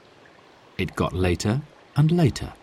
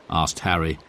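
usual speaking voice

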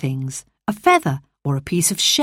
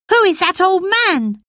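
put-on boy Colin; 13 semitones higher than usual. Colin asks.

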